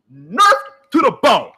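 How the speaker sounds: ordinary delivery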